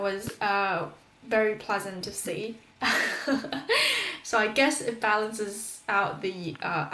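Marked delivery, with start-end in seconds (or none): 2.8-4.2 high-pitched amused laugh; real laugh. Real, not acted. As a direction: laugh at your own little story. Amused by her kettle story.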